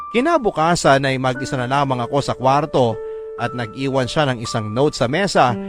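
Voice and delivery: normal talking voice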